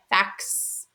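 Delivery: "Fax" with a separate long hiss stuck on the end; extra s added. Shown as a demonstration. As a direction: try tacking a bare s onto "Fax". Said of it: "add on just a s sound".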